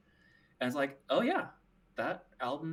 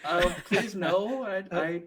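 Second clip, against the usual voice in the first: laughing plea; 5 semitones higher, 4 dB louder than usual. Pretend. Mock plea while laughing.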